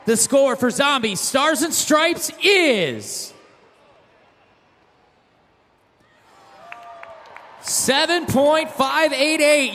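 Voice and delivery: slow, building score reveal; hype announcer. Real, not acted. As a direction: draw out the big reveal.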